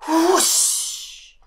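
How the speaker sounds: long, loud whooshing wind; slow loud whoosh of wind. A narrator's reading. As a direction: blow the word out like a gust. Wind sound drawn out.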